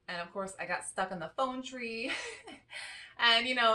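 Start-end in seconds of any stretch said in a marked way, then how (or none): 2.2-3.1 soft laugh. Real, not acted. Laughs at stuck phone tree.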